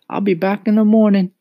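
put-on high, loud boyish farewell; boy's good night. A narrator's reading. Mario says good night to the cricket, high and keen.

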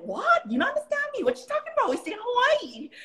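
retold Hawaii pidgin comeback, high and incredulous; indignant Hawaiian pidgin. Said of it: "when I would speak pidgin".

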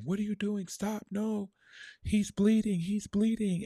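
high, frantic mother's cry to stop; mother pleading. Retold or imitated. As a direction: beg him to stop, he's bleeding. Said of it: "my mom kind of saying".

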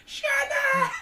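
very high-pitched, theatrical squeal; mock squeal. Friend teasing about expensive skincare, mock squeal. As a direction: squeal the brand name in mockery.

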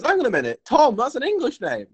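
high-pitched dawning doubt; mock puzzlement. Scottish dude doubting the English guy, mock puzzlement. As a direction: query it, pitch climbing. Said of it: "the Scottish dude goes".

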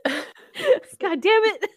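high, loud tearful laugh-talk; real tears breaking into exasperated laughter; laughing through tears. Real, not acted. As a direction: laugh at yourself for really crying. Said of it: "And I'm crying".

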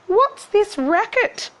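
put-on very high, slow girlish cry; high-pitched, indignant question; Cindy crying out. A narrator's reading. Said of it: "Cindy cried".

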